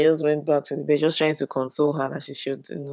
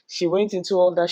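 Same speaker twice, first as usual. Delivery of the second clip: ordinary talk, nothing marked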